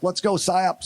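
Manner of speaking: lightly voiced sergeant's wake-up order; sergeant rousing the team. Special forces sergeant to sleeping psyop team, rousing order. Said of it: "He's like".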